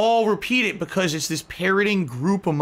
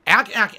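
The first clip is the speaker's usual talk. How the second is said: higher, louder barked ack ack; mock ack laugh; 6 semitones higher, 5 dB louder than usual. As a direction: spit out the meme laugh mockingly. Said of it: "I think is stupid".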